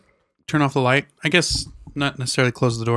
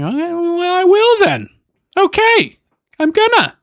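mock eager reply; 5 dB louder, slower than usual. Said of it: "I'm like".

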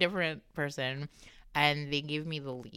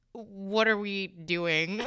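very slow, incredulous question; drawn-out, retold disbelief; baffled retort; much slower than usual. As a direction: stretch each word in mock bafflement. Baffled retort to doctor's pretence.

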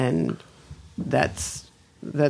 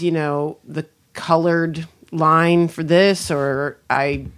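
usual speaking voice

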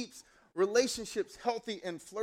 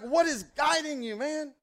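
high-pitched, exasperated demand; retold incredulous outrage; fired-up challenge; 10 semitones higher, 4 dB louder than usual. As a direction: confront the scammer, voice shooting up. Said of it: "I was so fired up".

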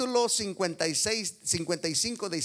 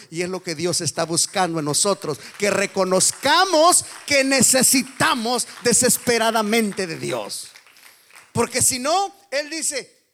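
much louder, higher, near shouting; full-force preaching; 6 semitones higher, 11 dB louder than usual. Acted. Pastor urging the congregation to admit need, loud preaching. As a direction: cry it out to the whole church.

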